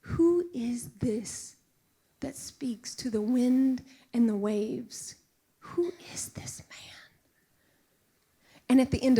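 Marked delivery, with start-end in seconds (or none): 0.0-7.0 hushed, wondering whisper; awed whisper. Retold or imitated. Disciples in the boat after the wind stops, awed whisper. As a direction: whisper it as if stunned by the storm stilled. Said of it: "the disciples were like".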